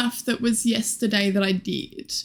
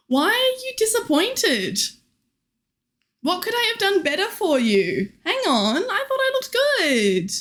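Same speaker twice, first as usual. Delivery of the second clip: mock wounded; 8 semitones higher than usual. Mock hurt at disappointed chat.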